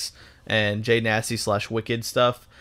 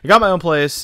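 higher and louder, punchier take; upbeat vlog intro delivery; excited retake; 7 semitones higher, 8 dB louder than usual. Shown as a demonstration. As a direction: sell the news with a burst of energy. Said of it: "Or should I put".

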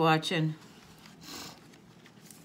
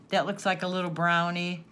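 normal talking voice

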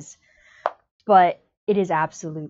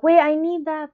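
higher, louder, a mock panicked cry; sudden panic; 9 semitones higher, 5 dB louder than usual. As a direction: yelp it out as if you just lost it. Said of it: "And then you're like".

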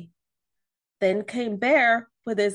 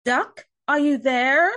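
higher, louder questioning call; 4 semitones higher, 6 dB louder than usual. A narrator's reading. Wolf calling to Duck through the door, calling out. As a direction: call out to find a friend. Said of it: "called Wolf".

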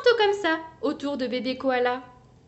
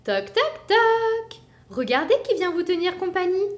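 higher, louder cheerful mother; playful mum; 8 semitones higher, 4 dB louder than usual. Acted. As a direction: announce the surprise visitor brightly. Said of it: "dit maman".

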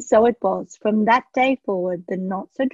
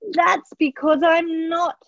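a high, slow, sniffly little dragon; sniffy dragon; 8 semitones higher, 3 dB louder than usual. A narrator's reading. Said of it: "sniffed the dragon".

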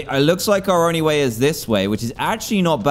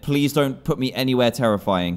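normal talking voice

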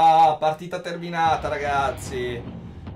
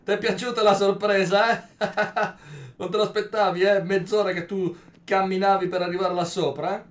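mocking taunt; 4 semitones higher, 7 dB louder than usual. Real, not acted. Taunting an enemy he just killed.

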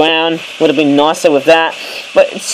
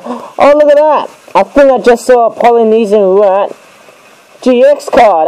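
high, thrilled burst at a rare pull; sudden delight at spotting the card; excited discovery; 6 semitones higher than usual. Real, not acted. Spots a rare card while unboxing.

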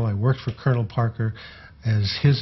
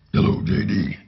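greeting dropped down into a very low bass; 3 semitones lower than usual. Retold or imitated. Tour manager mimicking J D back to him on the phone, very low. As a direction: mimic a bass singer's rumbling low talk. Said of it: "get down real low and talk a little bit like J".

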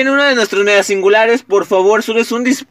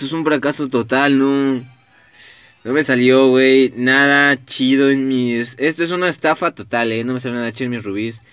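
dejected; 8 semitones lower, 3 dB quieter than usual. Real, not acted. Bad pull, rubies wasted.

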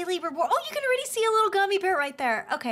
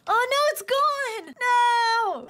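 higher, louder mock dismay; dismayed wail; 7 semitones higher, 5 dB louder, much slower than usual. Real, not acted. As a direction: wail it like the car is lost forever. Car vanishes down slide.

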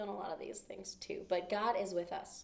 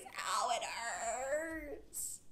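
slow, drawn-out mock whimper of pain; acted pain whimper; 3 semitones higher, much slower than usual. Shown as a demonstration. Teacher acting out pain for children, slow whimpering ow. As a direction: show the kids a hurting face in the voice. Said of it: "my pain is all over my face".

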